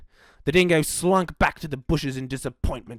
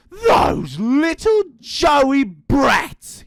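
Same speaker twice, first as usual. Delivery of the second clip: loud, very slow, higher angry shout; furious yell; 9 semitones higher, 8 dB louder, much slower than usual. Acted. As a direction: spit out the insult in rage. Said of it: "he yelled".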